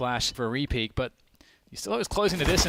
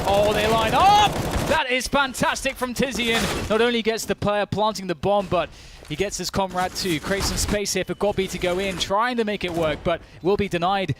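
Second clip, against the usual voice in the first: excited; 5 semitones higher, 3 dB louder than usual. Real, not acted. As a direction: roar the line-up kill. Caster calling a big play.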